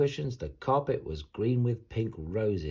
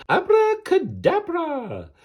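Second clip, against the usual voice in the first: high, slow, loud witch's incantation; stretched-out magic cry; big spell shout; 8 dB louder, slower than usual. Acted. Furious witch casts spell.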